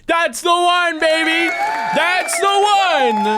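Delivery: high, loud excited yell; excited shouting. Real, not acted. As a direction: shout it out, thrilled. Excited about game sound.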